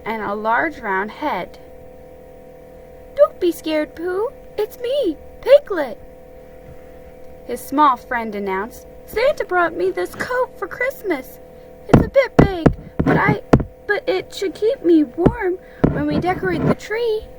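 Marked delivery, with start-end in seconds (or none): 3.2-6.0 high-pitched small friend, reassuring; little Piglet voice, pitched way up; Piglet, gentle little friend. Acted. Small friend announcing himself to Pooh, high and reassuring. 9.1-17.3 high-pitched little creature voice; Piglet, pleased little friend. Acted. Small friend showing Pooh his new coat, high and pleased.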